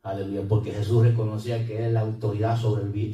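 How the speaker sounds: normal talking voice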